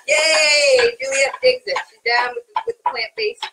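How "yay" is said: loud, drawn-out cheer; excited cheer. Real, not acted. Host celebrating the taster's approval, excited cheer. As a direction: let out a long, celebrating whoop.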